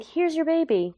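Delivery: high, chirpy mock nurse voice; breezy hand-off, way up high; mock brisk nurse. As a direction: toss it off like a hurried nurse. Nurses hand baby over briskly.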